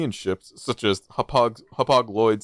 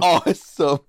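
laughing; 4 semitones higher, 5 dB louder than usual. Real, not acted. Laughing at Care Bear image.

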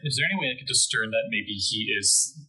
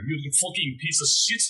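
in character; 7 semitones higher than usual. Shown as a demonstration. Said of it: "just do that in character".

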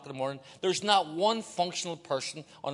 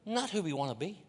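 ordinary talk, nothing marked

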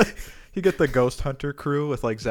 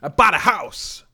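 triumphant; 7 dB louder, slower than usual. Real, not acted. Triumphant announcement.

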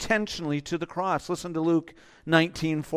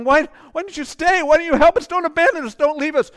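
high, loud desperate plea; pleading women; 11 semitones higher, 11 dB louder than usual. Retold or imitated. The women of Jerusalem begging Jesus not to leave, loud high plea. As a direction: cry out as if begging him to stay. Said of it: "You imagine these women, they're going".

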